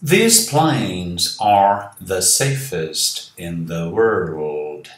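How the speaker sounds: slow, careful English story reading. A narrator's reading. Teacher reading the English story aloud to students, slow and careful. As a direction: read each word out slowly and clearly for learners.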